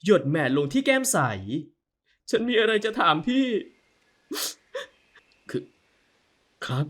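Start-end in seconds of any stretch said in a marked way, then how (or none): 2.3-6.9 high, tender questioning woman's voice; timid hopeful wife. Acted. Wife asks if he loves her.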